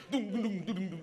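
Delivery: rhythmic mouth drumming; tam-tam imitation. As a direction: imitate a looping tom-tom soundtrack. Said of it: "une musique de tam-tam qui fait". (imitation de tam-tam à la bouche)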